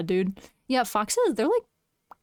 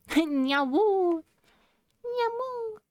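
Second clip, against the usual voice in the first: wolf voice; 10 semitones higher, much slower than usual. Pretend. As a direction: howl like a cat-wolf. Cat-wolf howl.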